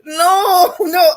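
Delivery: loud, high laughing protest; crying out no through laughter; half-laughing shout of no. Real, not acted.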